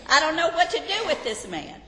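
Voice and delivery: a wife throwing up her hands to God, half laughing; exasperated wife's prayer. Pretend. Preacher plays a wife complaining to God about her husband, laughing. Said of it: "And he's hard to live with".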